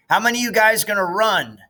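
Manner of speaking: put-on high, fast, pushy boy; Gary bossing the boys before the race; bossy demand. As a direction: fire off the question like a boss. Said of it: "Gary Fulcher demanded".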